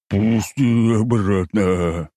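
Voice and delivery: slow furious growl of rage; shaking with rage, drag it out; furious bear. Acted. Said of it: "трясло от ярости".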